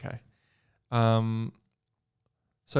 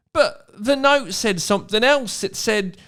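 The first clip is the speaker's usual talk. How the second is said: put-on higher, louder boy's voice; Rob's voice; 8 semitones higher, 5 dB louder than usual. Reading aloud, Rob presses Hermione about the note, voice raised. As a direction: push the question up high. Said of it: "Rob showed her the note".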